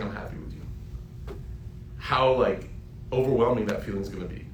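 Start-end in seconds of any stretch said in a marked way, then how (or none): 0.0-0.6 much softer, almost breathed; awed hush. Pretend. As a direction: hush it like holy words. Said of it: "Imagine Allah saying".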